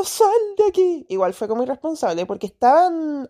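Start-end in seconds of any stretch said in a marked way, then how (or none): 0.0-1.1 shooing dog. Retold or imitated. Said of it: "se me acercaba".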